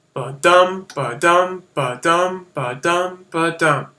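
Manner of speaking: ba-dum, light then heavy, the first loudest; iambic beat. Shown as a demonstration. Teacher chanting iambic pentameter's rhythm, steady weak-strong beats. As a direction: tap out ba-dum five times, weak-strong. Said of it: "the rhythm of the line would go".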